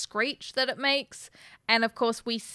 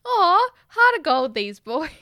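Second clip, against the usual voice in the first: mock gushing; 7 semitones higher, 5 dB louder than usual. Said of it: "And I'm like".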